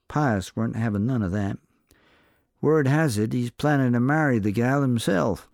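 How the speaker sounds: a sly saloon barkeep angling for a drink. A narrator's reading. Barkeep bargaining with the cowboy for gossip, sly.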